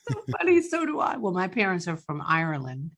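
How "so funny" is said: laughs through the words; amused, words riding a laugh. Real, not acted. Host laughing at the guest's buried accent, laughing.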